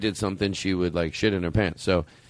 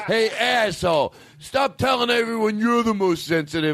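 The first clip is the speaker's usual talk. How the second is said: hotheaded shouting; 6 semitones higher than usual. Retold or imitated. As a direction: yell it like a touchy guy picking a fight. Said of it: "I, like".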